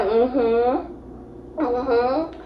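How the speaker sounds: acting out a small tipsy yes; quiet mock-guilty hum; sheepish drunk mm-hmm. Drunk self answering boyfriend's question.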